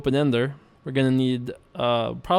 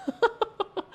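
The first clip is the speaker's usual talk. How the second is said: high, light giggle; short high-pitched laugh; 6 dB quieter than usual. Real, not acted. Streamer tickled by own leprechaun pants, giggling.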